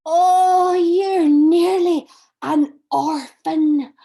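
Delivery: loud, slow, wide-eyed amazement; a stunned child blurting the news; shocked gasp. Acted. Child hears friend has no father.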